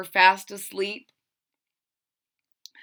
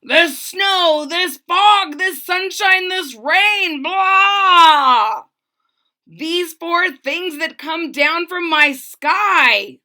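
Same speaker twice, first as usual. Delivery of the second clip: higher, louder royal shout with a roaring blah; slow, booming tirade at the weather; angry king shouting; 8 semitones higher, 9 dB louder, slower than usual. Acted. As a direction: rant at the sky like a furious king. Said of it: "he started shouting".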